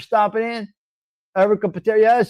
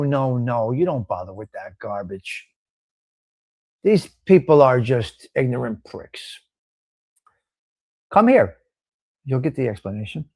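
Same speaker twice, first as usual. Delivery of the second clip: usual speaking voice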